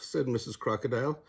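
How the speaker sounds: ordinary delivery, nothing marked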